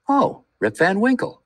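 sudden recognition, called out; exclaiming. A narrator's reading. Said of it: "exclaimed two or three".

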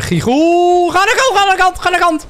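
high-pitched frantic yell; gamer's high squealed shouting; excited yelling. Real, not acted. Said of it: "Lotte vraagt of je niet zoveel schreeuwt".

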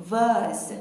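British "vase" as "vahz", falling; British accent. Shown as a demonstration. (تلفظ بریتیش vase به شکل واز)

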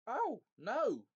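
loud, high, exasperated howl; Trumpkin bellowing. A narrator's reading. Dwarf squashed in the dark, bellowing in protest. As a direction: bellow it with big swoops on each word. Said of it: "bellowed Trumpkin".

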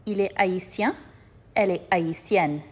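ordinary talk

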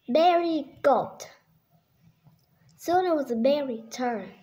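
ordinary delivery

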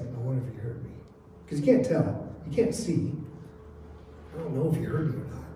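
soft, low private musing; hushed wondering. Acted. Blind man wondering if Jesus heard him, hushed. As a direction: drop right down, as if unsure of being heard. Voices the beggar's private doubt.

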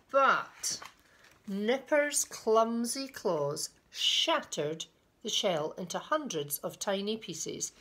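drawn-out, emphatic storybook reading; slow, dramatic storyteller; story narration. A narrator's reading. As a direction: stretch the key words for small listeners. Reads a picture book aloud.

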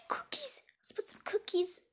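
hushed, voiceless whisper. Acted. Sister packing cookies before Daddy comes, whispering. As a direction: whisper it like a secret. Sneaking snacks.

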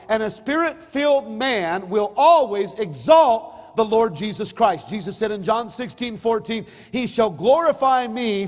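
loud, high, slow preaching to the congregation. Acted. Pastor preaching loudly to his congregation. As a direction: preach it out to the pews.

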